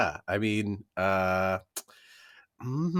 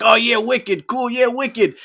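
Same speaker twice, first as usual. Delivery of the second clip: put-on loud, higher Boston accent; hammed-up Boston accent; 11 semitones higher, 6 dB louder, slower than usual. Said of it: "these Boston accents".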